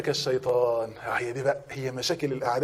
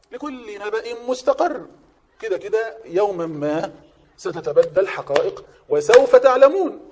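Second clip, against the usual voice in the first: recitation; 5 semitones higher, 5 dB louder, much slower than usual. Acted. Quranic phrase recited in lesson.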